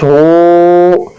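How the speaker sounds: "हू" held long and steady; stretched vowel. Shown as a demonstration. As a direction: draw out the oo in "हू". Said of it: "इसको खींचेंगे".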